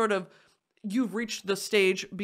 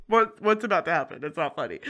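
ordinary talk

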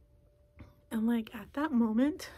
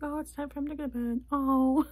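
higher-pitched, playfully let-down "oh"; mock disappointed whine; 4 semitones higher, 4 dB louder than usual. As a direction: re-enact a small letdown, last "oh" louder. Acting out disappointment at his bedtime.

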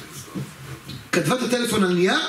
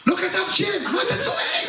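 very high, hammed-up squawk; exasperated scolding; 12 semitones higher than usual. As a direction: scold him in a strained high yell. Scolding the shouting friend. (נזיפה צווחנית ומוגזמת)

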